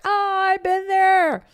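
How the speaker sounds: high, slow, casual mocking voice of the commenters; imitating followers replying to her skunk post, high and slow. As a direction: drawl it like a know-it-all dog owner. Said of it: "everyone's like".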